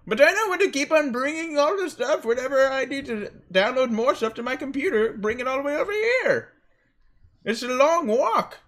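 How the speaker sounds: high, whiny mock customer voice. Pawn shop clerk mocks a lazy customer, high whiny voice. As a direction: pitch it up, whining about the walk.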